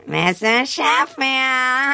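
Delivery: Fran Drescher. Acted. Nano impression of a sitcom star, long drawn-out whine. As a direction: stretch the last syllable into a long held honk. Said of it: "Fran Drescher would just be the".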